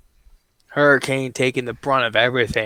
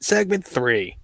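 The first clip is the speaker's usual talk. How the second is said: ordinary talk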